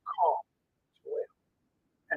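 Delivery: soft breathy whisper of a voice from above; whispered call. Retold or imitated. God whispering to the driver to call Joelle, hushed whisper. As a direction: let the words barely come out, unvoiced. God speaking to him in car.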